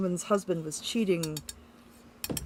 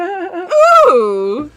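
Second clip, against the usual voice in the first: high, loud delighted squeal; delighted ooh; 7 semitones higher, 15 dB louder than usual. Real, not acted. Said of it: "Perfectly summarized".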